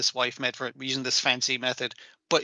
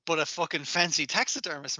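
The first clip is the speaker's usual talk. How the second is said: ordinary delivery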